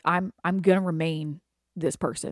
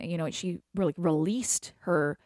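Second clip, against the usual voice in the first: normal talking voice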